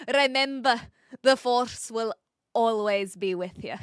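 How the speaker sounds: amateur Scottish movie quote; hammed-up Scottish accent. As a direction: attempt a Scots accent, amused at yourself. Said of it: "my best attempt at a Scottish accent".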